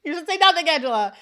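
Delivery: loud high scold, naming her; mock scolding. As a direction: scold the character, half joking. Repeats scolding, addressing Angela.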